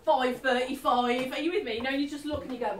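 ordinary talk, nothing marked

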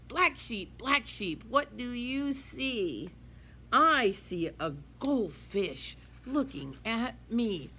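put-on lively sing-song read-aloud for kids; reading to children. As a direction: read it bright and bouncy to a class. Teacher reads picture book aloud.

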